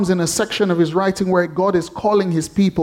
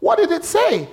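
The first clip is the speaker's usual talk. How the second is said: very high eager question; high curious; 12 semitones higher than usual. Retold or imitated. Owner pressing for the parrot's last words, high. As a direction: ask it up high, hooked.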